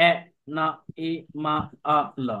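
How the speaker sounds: syllable by syllable, each sound split; spelled out by sounds. Shown as a demonstration. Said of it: "দেখো, এটা হবে".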